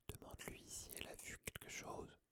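barely audible, voiceless whisper; mouthed whisper. A narrator's reading. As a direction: mouth it almost silently. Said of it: "en remuant les lèvres silencieusement". (chuchotement presque muet)

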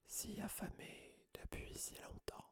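far-off, voiceless menacing whisper; cold deadly voice. A narrator's reading. Hidden monster in the walls hissing for blood, whispered. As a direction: breathe the threat like an unseen monster. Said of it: "C'était la même voix".

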